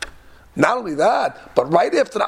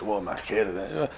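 lower, much softer and slower ma'achelet, drawn out; mock posh Hebrew; 9 semitones lower, 10 dB quieter, slower than usual. Teasing the fancy word for a knife, slow and hushed posh. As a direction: savour ma'achelet like a snob. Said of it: "that's the Queen's English".

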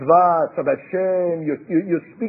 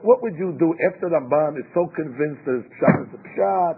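usual speaking voice

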